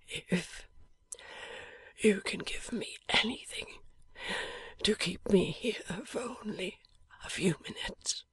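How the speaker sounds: barely voiced, dying breath; a dying man's faint whisper. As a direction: whisper it weakly from a sickbed.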